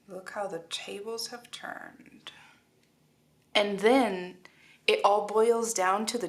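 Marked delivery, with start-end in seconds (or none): 0.1-2.3 hushed dramatic whisper. Real, not acted. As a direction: whisper it like a secret. Dramatic card reading aside.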